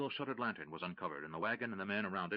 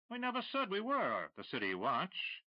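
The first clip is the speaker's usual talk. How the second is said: mocking; 10 semitones higher than usual. Hidden voice mocking smuggler.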